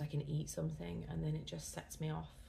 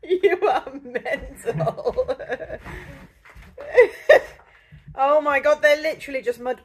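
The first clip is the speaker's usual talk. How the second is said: laughing through the words; high-pitched laughing fit; laughing hard; 11 semitones higher, 22 dB louder than usual. Real, not acted. Laughing at partner's antics.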